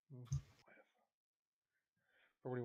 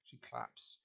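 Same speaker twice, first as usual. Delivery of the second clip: ordinary talk, nothing marked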